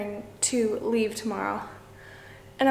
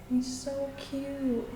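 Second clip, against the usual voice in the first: cooing; 4 semitones higher, 3 dB quieter, much slower than usual. Real, not acted. Cooing at a gecko.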